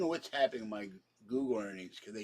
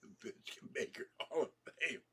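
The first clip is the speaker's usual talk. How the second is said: a hint of a breathy announcer, higher pitch; mock grand title; 5 semitones higher than usual. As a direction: announce it like a fake award. Joke announcement, then laughs.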